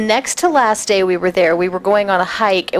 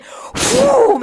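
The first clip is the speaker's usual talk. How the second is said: high-pitched whoosh of a sudden drop; imitated falling whoosh. Camper dropped while rappelling, high imitated whoosh. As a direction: voice the sound of plummeting. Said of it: "and I went".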